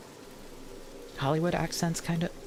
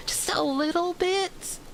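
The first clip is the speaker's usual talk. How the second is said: mock pleading. Pleading for voice post-processing.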